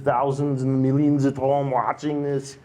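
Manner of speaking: put-on German accent; host playing up a German accent. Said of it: "That's a good German accent".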